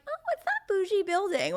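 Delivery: high, lightly voiced snooty wondering; mock snooty wonder. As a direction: ask it like a curious passer-by, a touch hammed-up. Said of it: "being like".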